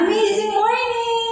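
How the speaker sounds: sung-out, high "amazing morning!" with a lilt; excited host greeting. Shown as a demonstration. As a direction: greet the room like an upbeat event host. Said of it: "Ito naman yung".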